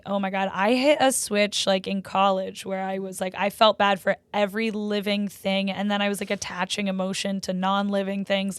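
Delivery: ordinary talk, nothing marked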